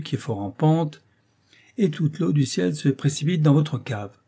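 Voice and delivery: récit posé, plus aigu et plus fort que la narration; dérouler l'histoire comme un piège; vieux clerc qui raconte. A narrator's reading. Clerc tells the hypothetical. (le vieux clerc expose un exemple au cuisinier, voix un peu plus haute et appuyée)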